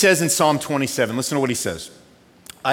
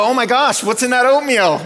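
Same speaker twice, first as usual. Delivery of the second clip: high, loud panicked outcry; husband's hammed-up panic over oatmeal; 10 semitones higher, 8 dB louder than usual. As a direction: cry out in mock horror. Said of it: "and I go".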